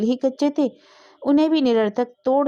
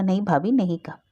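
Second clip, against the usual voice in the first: plain reading voice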